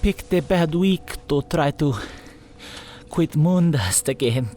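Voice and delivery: put-on weak and high, in a thick northern accent; a frail girl with a thick northern accent. A narrator's reading. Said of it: "in her thick northern accent".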